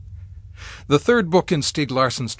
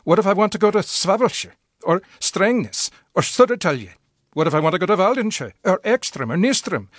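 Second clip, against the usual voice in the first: raised-pitch insistent string of questions, town names tumbling out; pleading character; 8 semitones higher than usual. Acted. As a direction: press the point, rising higher with each place. Character's pleading list of places.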